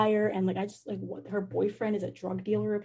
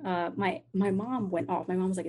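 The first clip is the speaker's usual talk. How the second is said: ordinary talk, nothing marked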